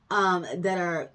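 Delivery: ordinary delivery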